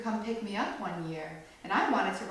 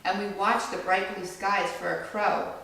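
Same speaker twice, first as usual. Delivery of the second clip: gentle storybook read-aloud; read-aloud to a small audience; reading to children; 6 dB louder than usual. A narrator's reading. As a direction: read it like a picture book for kids. Reads picture book to children.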